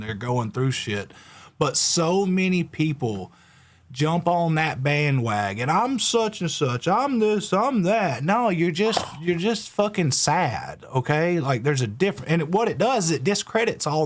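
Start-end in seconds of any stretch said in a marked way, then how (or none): 5.7-8.2 higher, slow sarcastic mimicry of attention-seekers; mocking quote. Mocking people who jump on the bandwagon, sneering mimicry. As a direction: parrot them with scorn.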